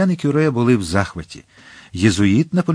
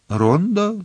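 high, scornful question; disdainful Рондо?; 9 semitones higher than usual. Acted. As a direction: sneer at the word Рондо. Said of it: "зневажливо мовив єзуїт".

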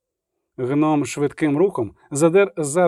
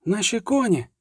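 crying out; 6 semitones higher than usual. A narrator's reading. Milva calls for the horses, crying out. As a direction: call out in a raised pitch. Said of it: "крикнула Мільва".

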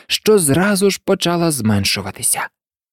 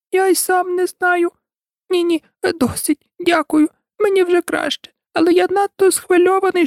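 very high little creature squeak; feeble squeaky voice. A narrator's reading. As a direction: squeak it out like tiny, weak Bill. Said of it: "Останнім почувся кволий, писклявий голосок". (кволий писклявий голосок)

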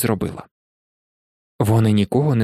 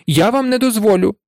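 higher-pitched, stubborn refusal; firm protest from a nervous boy; defiant; 7 semitones higher than usual. A narrator's reading. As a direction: stand your ground, voice raised in pitch. Neville defiantly blocks his friends' way.

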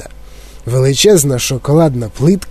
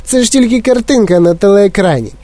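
ordinary delivery, nothing marked

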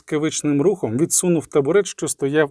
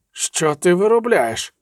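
slow, pitched-up, drawn-out protest; moaned dismay; 4 semitones higher, slower than usual. A narrator's reading. Vesemir questions what the sorceress is doing, moaning. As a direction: groan the question slowly. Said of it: "простогнав Весемір".

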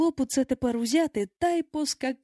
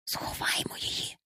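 urgent, hushed whisper; soft voiceless hiss; 7 dB quieter than usual. A narrator's reading. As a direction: whisper it to fellow plotters. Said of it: "прошепотів хтось".

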